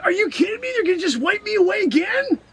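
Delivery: can't believe they'd erase him; outraged disbelief. Acted. Streamer complaining to chat, high outraged.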